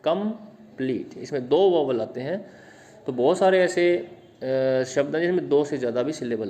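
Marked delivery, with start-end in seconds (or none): 0.0-1.2 slow "complete" with com and pleet held apart; syllable division. Shown as a demonstration. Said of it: "तो दो में divide कर सकते हैं".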